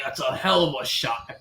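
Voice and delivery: put-on low Boston-accented praise; fake Boston accent. Golfer praising another group's drive, fake Boston accent. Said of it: "the fake Boston accent".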